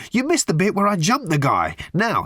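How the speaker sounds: worked-up, high-pitched complaint; raging. A narrator's reading. Ford scolds Arthur for missing his story, raging. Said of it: "raged Ford".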